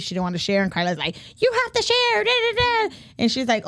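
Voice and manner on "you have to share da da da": very high, squeaky mock yell; shrieky imitation of a nagging aunt; squeaky yelling woman. Tia ordering kids to share candy, squeaky mock yelling. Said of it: "she yells so much".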